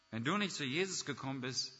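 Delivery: ordinary talk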